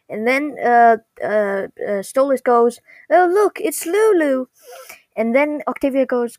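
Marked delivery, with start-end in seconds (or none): 3.1-4.5 high, excited imitation; delighted. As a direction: point out a mascot with mock delight. Stolas delightedly spots the mascot.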